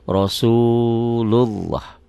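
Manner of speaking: "Rasulullah" very slow with a rounded "lo"; thick Rasulullah. Shown as a demonstration. Teacher modelling the thick lafaz slowly. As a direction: draw "Rasulullah" out, dark and full. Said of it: "yang warna merah".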